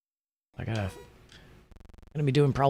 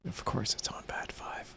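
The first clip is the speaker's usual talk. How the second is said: whisper; 10 dB quieter than usual. Real, not acted. Muttered aside to self.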